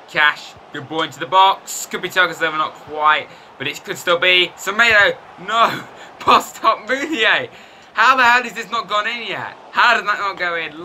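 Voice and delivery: high, loud, frantic commentary; frantic play-by-play. Real, not acted. Watching his side miss chances, frantic commentary. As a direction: call each near miss in disbelief.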